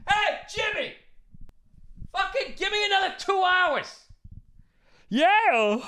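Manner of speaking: high, far-off hollering festival-goer; shouting to the stage. Retold or imitated. Tired concertgoer hollering at [name] from the field. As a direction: yell up at the stage in a high put-on voice.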